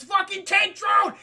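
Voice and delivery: high, loud, slow shout; alarmed yell. Retold or imitated. Hero spotting the tank drone, shouting.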